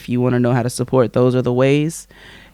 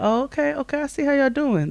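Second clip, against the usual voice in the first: sarcastic high voice; 11 semitones higher, 6 dB quieter than usual. Retold or imitated. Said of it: "I was like".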